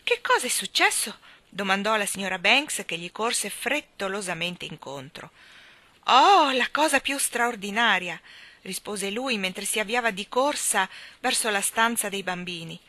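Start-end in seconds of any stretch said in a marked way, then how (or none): 0.0-1.2 high, louder worried wife; startled question. A narrator's reading. Wife hurries to meet him. 6.0-8.2 loud, drawn-out marvelling; excited amazement. A narrator's reading. Husband brings amazing news.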